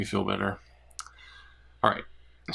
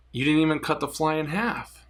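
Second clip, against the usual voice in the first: higher, protesting complaint; disappointed guy; 7 semitones higher than usual. Acted. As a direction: sound let down by the trick.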